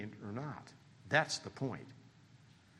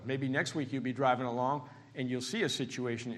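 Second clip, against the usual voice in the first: ordinary talk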